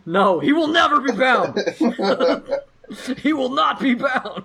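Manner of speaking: high, laughing mock vow; laughing defiance. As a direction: declare it through giggles. GM voicing defiant bear, laughing.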